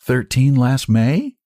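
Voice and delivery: put-on girl's voice. A narrator's reading. Shy girl answers her age.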